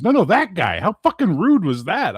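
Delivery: high, loud, outraged buddy; "No, no, that guy" thrown out high in disbelief; buddy's indignant outburst. Retold or imitated. Buddy backing him up at the restaurant, indignant. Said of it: "He goes".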